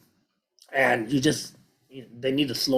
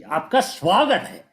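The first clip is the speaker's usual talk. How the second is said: higher, louder, energetic welcome; 5 semitones higher, 6 dB louder than usual. Shown as a demonstration. Teacher shows learners how to say you're welcome, energetically. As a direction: say it with a lot of energy. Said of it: "Say it with a lot of energy".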